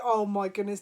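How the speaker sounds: ordinary talk, nothing marked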